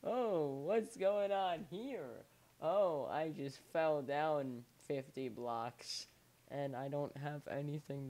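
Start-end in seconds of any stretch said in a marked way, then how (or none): 0.0-6.1 loud, drawn-out mock reaction; mock surprise. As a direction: act out a slow, exaggerated "oh" moment. Imagined reaction to a fall.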